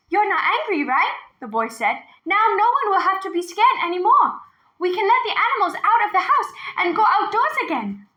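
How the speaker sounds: put-on high, eager little boy's voice; hopeful boy. A narrator's reading. Boy hoping grandfather isn't angry, high and eager.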